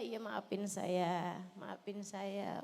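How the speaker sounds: slow, soft, humble plea; meek apology. Retold or imitated. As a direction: say sorry very softly and slowly, giving in. Wife humbly apologising to husband. (istri minta maaf pada suami, pelan dan lembut)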